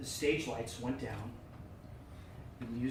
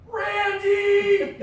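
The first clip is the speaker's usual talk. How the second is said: high loud shout across the crowd; shouted name; 12 dB louder than usual. Retold or imitated. Dustin spots Randy after the show, loud shout. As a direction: yell his name from afar.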